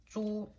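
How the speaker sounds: "zhu" high and even; level first tone. Shown as a demonstration. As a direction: say "zhu" on a high steady pitch. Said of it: "level tone".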